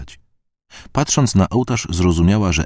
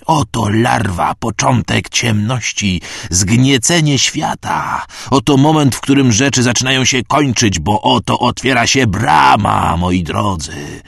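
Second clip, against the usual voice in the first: slow, high, loud fanatic sermon; a burnt priest hails the gate to his congregation, slow, loud and ecstatic; 5 semitones higher, 4 dB louder, slower than usual. A narrator's reading. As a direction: preach the apocalypse in rapture.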